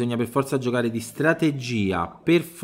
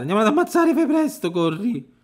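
much higher frantic urging; panicked high call to hurry; urgent squeak; 9 semitones higher than usual. Real, not acted. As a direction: hurry him on, voice cracking up. Urgent rush to kill enemy.

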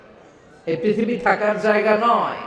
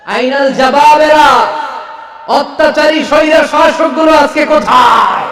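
higher, much louder shout; thunderous demand; 9 semitones higher, 7 dB louder than usual. A narrator's reading. Preacher voicing God demanding where the tyrants went, roaring. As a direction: roar out the challenge.

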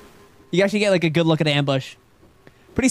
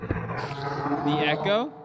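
cave echo; 6 semitones lower, 6 dB quieter, much slower than usual. Real, not acted. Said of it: "that's why it's echoing".

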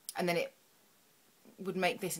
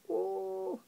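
delighted ooh; 13 semitones higher than usual. Real, not acted. Reacts to the glitter pot.

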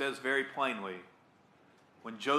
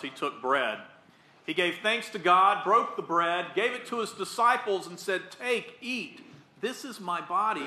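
rising, louder narration of the supper; solemn proclamation; 3 semitones higher, 4 dB louder than usual. Real, not acted. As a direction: tell the Last Supper with reverence. Words of Institution.

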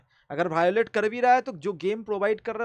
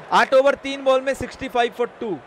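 raised score-update voice; live play-by-play; 4 semitones higher, 6 dB louder than usual. Acted. Streamer updates viewers on score and partnership, commentary voice. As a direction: read out the match situation, pitched up and projected. Gives score and match situation.

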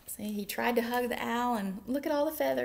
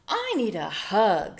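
bear voice; 5 dB louder than usual. Acted.

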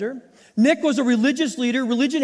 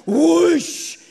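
whoosh; 5 semitones higher, 5 dB louder than usual. Retold or imitated. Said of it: "I think that's how it sounded".

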